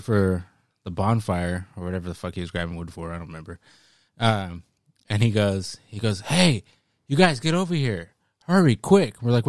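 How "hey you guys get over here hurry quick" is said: urgent call. Retold or imitated. Uncle calling kids over.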